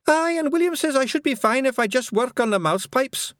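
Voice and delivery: high, loud Scots pixie; tiny Scots pixie, quick in places. A narrator's reading. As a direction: squeak it out like a wee Feegle. Pixie continues.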